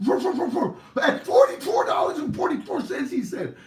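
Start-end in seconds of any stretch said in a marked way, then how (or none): 0.1-0.8 high, loud yappy barks; rapid string of high barks; dog barking. Acted. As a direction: bark like a little dog reading lips. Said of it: "Rover, how much?".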